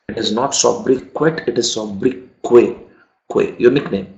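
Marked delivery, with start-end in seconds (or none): 0.1-1.4 sobriquet with a sounded t at the end; wrong pronunciation. Shown as a demonstration. 1.5-2.7 sobriquet ending in kay, the t silent; correct pronunciation. Shown as a demonstration.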